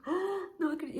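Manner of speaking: high, stunned cry of disbelief; retold cry of disbelief. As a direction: gasp it out as if you can't believe the news. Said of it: "eu dei um grito". (grito de espanto, bem agudo)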